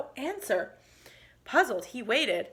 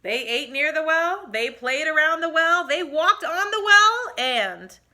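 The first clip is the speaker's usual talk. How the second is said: building excitement; 5 semitones higher, 7 dB louder than usual. A narrator's reading. Narrator building suspense as the boys play on the well. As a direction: voice climbing louder with each line, well to well.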